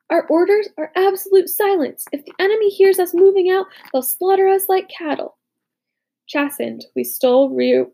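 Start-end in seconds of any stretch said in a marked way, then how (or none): 0.1-5.4 angry. A narrator's reading. Furious officer demands silence.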